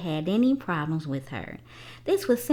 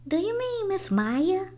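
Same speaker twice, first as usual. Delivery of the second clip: put-on high girl's voice, asking eagerly; a young girl checking a name; curious young girl; 11 semitones higher than usual. A narrator's reading. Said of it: "Rosarin asked".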